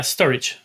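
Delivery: "storage" said like the everyday English word; correct name pronunciation. Shown as a demonstration. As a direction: run "storage" together as STOR-ij, no separate J. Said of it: "Is that how it's pronounced".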